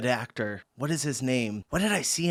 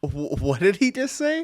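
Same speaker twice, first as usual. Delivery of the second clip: surprised; 9 semitones higher than usual. Real, not acted. Stunned by clip line.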